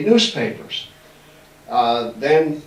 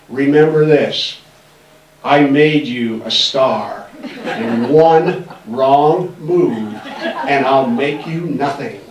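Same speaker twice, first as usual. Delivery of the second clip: slow, louder, deliberate threat with a chuckle; slow warning; 5 dB louder, much slower than usual. Retold or imitated. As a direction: lean in and lay down the law slowly.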